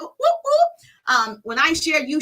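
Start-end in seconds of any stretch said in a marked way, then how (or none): 0.0-0.7 high, loud hype whoop; celebratory whoop. Real, not acted. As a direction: whoop it up for the arrivals. Cheering arrivals on livestream.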